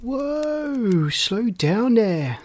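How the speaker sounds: drawn-out, high "whoa" to halt someone; protective, stretched-out intervention; protective big brother. A narrator's reading. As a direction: step in and slow them down. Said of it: "in a protective big brother tone".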